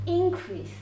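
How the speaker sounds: Increase as a noun, IN high and strong; stress on first syllable. Shown as a demonstration. Teacher correcting how she read Increase, first syllable stressed. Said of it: "sorry, I read it wrong".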